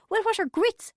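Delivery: very high, puzzled query; retold squawk of bafflement; incredulous question. A narrator's reading. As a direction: ask it high, baffled by the word. Mother quotes her own question.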